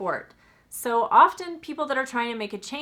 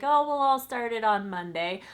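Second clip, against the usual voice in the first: lackadaisical excuse; 4 semitones higher than usual. Retold or imitated. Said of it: "being sort of lackadaisical about like".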